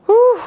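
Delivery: a high, loud strained whew. Real, not acted. Coach pushing through the eighth rep, strained whew. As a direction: blow it out at the hardest rep. Exhausted mid-set exhale.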